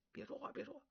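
whispering to keep a companion quiet; urgent whisper. Acted. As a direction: shush him under your breath. Eavesdroppers hush each other.

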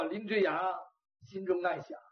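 ordinary delivery, nothing marked

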